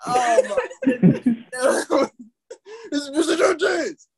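high squealing laugh; helpless with laughter; laughing hard. Real, not acted. Quoting the friend's complaint, howling laughing. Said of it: "I'm, like, crying in the strip club".

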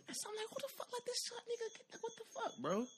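very high, laughing disbelief; high shocked squeal. Retold or imitated. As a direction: squeal it like you can't believe it. Baffled by the short guy.

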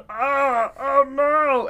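high, far-off Muppet-like wail, mock; silly Muppet cry. Soldier tangled in barbed wire, squeaky mock wail. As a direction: whimper oh no like a puppet. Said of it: "a voice just, just like that".

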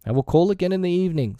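high, quick professional sign-off; pretend doctor. A narrator's reading. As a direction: promise briskly as a boy playing doctor. Slightly plays doctor.

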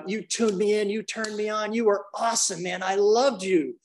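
high, building excited praise to a student; rising enthusiastic hype; gushing praise. Retold or imitated. Speaker hyping Charlie in class.

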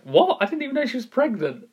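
high-pitched, incredulous retelling; mock astonishment, pitched well up; shocked reaction. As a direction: act out being stunned by surprise news. Acts out his shocked reaction.

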